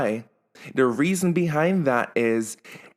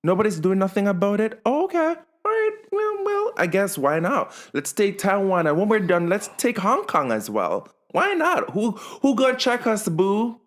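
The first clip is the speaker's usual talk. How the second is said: sassy high mock voice, pitched way up; mocking China; 6 semitones higher than usual. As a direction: play China as a sassy diva daring anyone. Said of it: "China's like".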